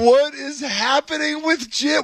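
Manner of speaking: high-pitched, drawn-out disbelief; baffled outburst. Real, not acted. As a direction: cry out in bewilderment. Host's baffled reaction to the clip.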